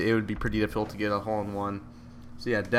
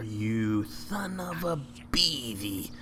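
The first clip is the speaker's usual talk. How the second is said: slow mock curse; 5 dB quieter, much slower than usual.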